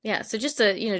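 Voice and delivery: ordinary talk, nothing marked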